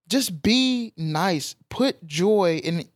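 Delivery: very slow, each word weighed; slow it right down to drive it home; deliberate slow plea; slow emphasis. Acted. Urging listeners to spread joy.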